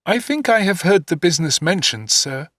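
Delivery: lightly voiced small boy, unsure; timid young boy. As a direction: answer nervously to the stern stepfather. Young David answering timidly.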